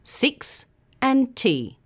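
"Six" and "T" said slowly as two separate beats; syllables split apart. Shown as a demonstration. Teacher splitting a word into syllables for learners. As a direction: break "sixty" into its two syllables. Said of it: "So does sixty".

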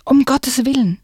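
high panicked cry; alarmed shout. Acted. Will seeing the ice crack, panicked high shout. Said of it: "schrie Will".

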